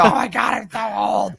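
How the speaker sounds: high-pitched hammed-up wail of dismay; mock panic. Mimicking people who just turned twenty-five, mock panic. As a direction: whine it like someone freaking out. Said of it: "losing their fucking mind".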